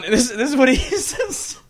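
ordinary talk

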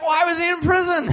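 high, loud shouted question; alarmed demand. Retold or imitated. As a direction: blurt it out in acted panic. Acts out alarmed question to roommate.

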